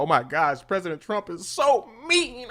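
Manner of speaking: sarcastic imitation of offended critics; mocking whiny quote. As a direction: parody the pearl-clutching critics. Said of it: "talking about".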